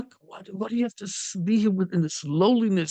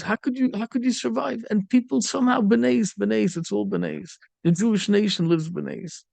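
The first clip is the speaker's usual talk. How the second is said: usual speaking voice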